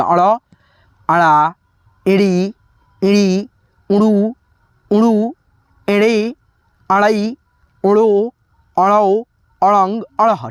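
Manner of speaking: अड़ said with a clear flapped ड़ on every syllable; careful pronunciation drill. Shown as a demonstration. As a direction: recite अड़, अड़ा, इड़ी one by one with a crisp ड़ flap. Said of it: "मेरे उच्चारण को सुन करके अच्छे से एक बार प्रैक्टिस कीजिए".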